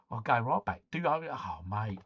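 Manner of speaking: ordinary talk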